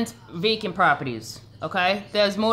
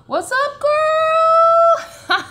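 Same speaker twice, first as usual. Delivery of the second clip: very high, sing-song squeal with a long last word; whooping greeting; 8 dB louder, much slower than usual. Real, not acted. Streamer greeting a viewer who just arrived, whooping hello. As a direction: squeal it loud and stretch out the end.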